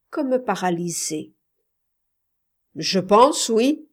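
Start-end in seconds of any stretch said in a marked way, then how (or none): 2.8-3.9 loud, irritated, rising reply; angry tone. A narrator's reading. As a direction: answer louder, with restrained anger. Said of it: "dit-il d'un ton colère".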